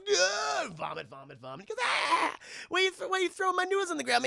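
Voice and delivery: high, fast horrified yell; mock shriek of disgust; Michael screaming at noodles full of worms; panicked scream.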